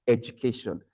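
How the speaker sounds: "education" said deliberately with a j sound; correct affricate pronunciation. Shown as a demonstration. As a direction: model "education" as ej-u-kay-shun. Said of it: "So you're supposed to pronounce it".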